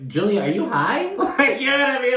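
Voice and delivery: high-pitched question breaking into laughter; amused disbelief. Retold or imitated. Viewer reacting to Julia being so weird, laughing disbelief. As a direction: ask it incredulously, laughing through it. Teasing a weird-acting cast member.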